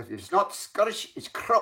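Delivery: high-pitched mock Scottish accent; Scottish movie-line impression. Guest quoting the movie's big line back, mock Scottish accent. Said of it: "the big line in, in that".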